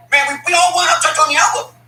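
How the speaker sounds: high, loud mock pleading; mocking imitation. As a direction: mimic fickle fans way up high. Mimicking fans changing their minds.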